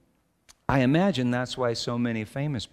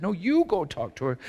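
raised-pitch mock bickering; insistent refusal; 7 semitones higher than usual. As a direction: toss the job back, acting out a squabble. Acted back-and-forth argument with wife.

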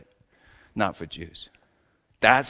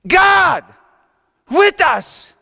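loud exclamation; 11 dB louder, much slower than usual. Acted. Newborn crying out.

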